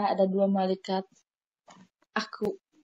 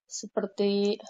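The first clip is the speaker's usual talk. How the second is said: ordinary talk, nothing marked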